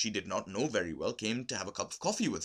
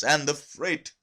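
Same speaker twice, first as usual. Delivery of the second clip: louder, higher cry of dismay; 5 semitones higher, 7 dB louder, slower than usual. A narrator's reading. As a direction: exclaim in mock horror. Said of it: "she cried".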